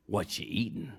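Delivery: put-on disgusting goblin voice. Ten-eyed goblin eyeing Herschel's pickles, goblin voice. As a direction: ask it as a big nosy goblin. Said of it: "this disgusting ten-eyed goblin".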